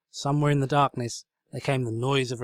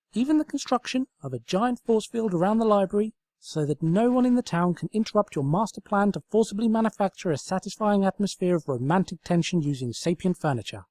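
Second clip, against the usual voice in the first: high, loud little lamp voice; squeaky approving lamp; lamp character; 9 semitones higher, 4 dB louder than usual. Acted. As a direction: chirp it up as a tiny eager lamp. Nightlight Sparkle continues.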